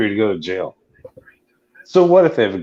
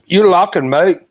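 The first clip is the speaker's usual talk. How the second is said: higher, louder mock Australian; put-on Australian accent, pitched up; 10 semitones higher, 6 dB louder than usual. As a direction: throw it back as a loud Aussie. Joins in the Australian accent game.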